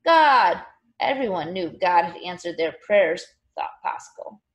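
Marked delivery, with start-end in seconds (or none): 0.0-0.6 louder, higher one-word response; crowd's ready answer. A narrator's reading. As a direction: answer firmly as a crowd, without hesitation. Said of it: "everyone said".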